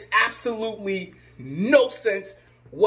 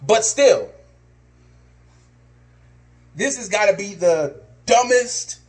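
normal talking voice

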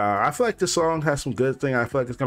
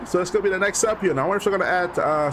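normal talking voice

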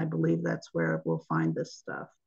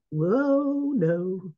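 touch of fear; 3 semitones higher than usual. Real, not acted. Presenter losing the slide mid-talk, mildly alarmed. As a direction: let mild alarm jump into the voice. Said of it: "Did you hear the fear in my voice".

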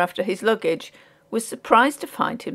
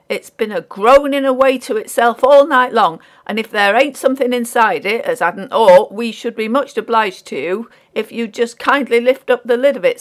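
louder, higher working man's voice; insistent porter; 4 semitones higher, 8 dB louder than usual. A narrator's reading. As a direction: press the complaint hard, rustic style. Porter's account and demand.

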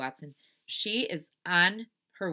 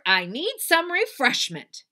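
high, slow, loud little cowboy; Leroy Ninker; 9 semitones higher, 9 dB louder, slower than usual. Acted. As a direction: announce it grandly, drawn out. Cowboy complaining in story.

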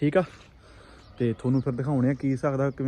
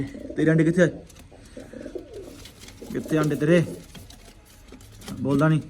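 scolding. Real, not acted. Scolding pigeon about eggs.

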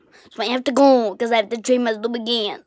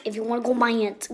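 ordinary delivery

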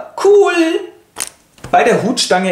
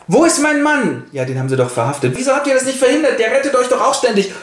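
high, indignant wife voice; upset wife; 10 semitones higher, faster than usual. Acted. Tell's wife storms in asking for her husband, high and indignant. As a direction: demand answers in a high, upset voice. Frau Tell bursts in upset.

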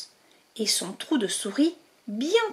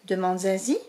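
plain reading voice

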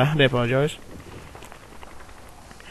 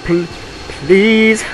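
loud pleading; 6 semitones higher, 7 dB louder, much slower than usual. Real, not acted. Begging viewers to subscribe.

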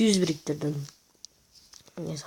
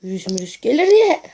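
high, loud mocking singsong; mock the worry in a silly voice; 7 semitones higher, 8 dB louder than usual. Mocking repeat of virus worry.

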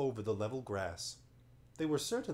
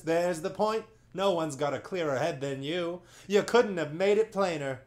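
high, loud flattering voice; fawning chorus; 6 semitones higher, 8 dB louder than usual. Acted. Monopods praising their chief's wisdom, loud and fawning. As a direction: gush over the chief.

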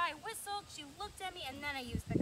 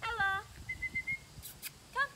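loud, high excited recall call; 8 semitones higher, 3 dB louder than usual. Shown as a demonstration. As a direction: call the dog in, high and eager. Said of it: "Using an excited tone of voice".

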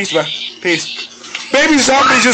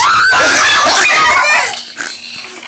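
long, high helpless laugh; uncontrollable laugh. Real, not acted. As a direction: crack up at a friend's failure. Cracking up at friend's failure.

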